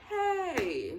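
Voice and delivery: high, airy mock-cool hello; breezy pretend greeting in a high voice; casual cool greeting. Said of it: "I'd be cool about it".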